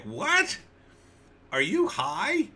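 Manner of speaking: an outraged exec hearing a crazy pitch; incredulous executive. Retold or imitated. Eighties executive laughing off a pitch, incredulous.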